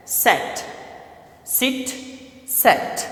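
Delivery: "सेट" and "सिट" said softly, one by one; vowel demo. Shown as a demonstration. Teacher contrasting "सेट" and "सिट" for the class. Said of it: "word जो हमारे पास है सेट".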